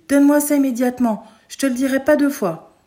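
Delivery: loud, high furious command; shouted order. Acted. Mother yells at her son to give up the gun. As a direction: bark the order at the child. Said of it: "elle me crie".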